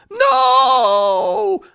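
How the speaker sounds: high, loud anguished wail of No; a crowd of goblins howling in grief; goblins crying out. Acted. Goblins shout their despair at the news. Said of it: "several goblins cried".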